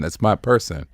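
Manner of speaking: ordinary talk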